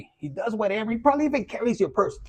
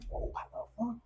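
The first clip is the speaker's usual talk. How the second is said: whispered reaction; 4 semitones higher, 12 dB quieter than usual. Retold or imitated.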